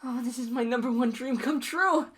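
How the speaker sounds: put-on overjoyed girlfriend answering a marriage proposal; ecstatic. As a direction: squeal with delight at the ring.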